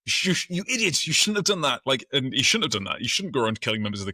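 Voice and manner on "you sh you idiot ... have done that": high, loud mock scolding. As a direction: tell him off like an exasperated boss. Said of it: "He's like".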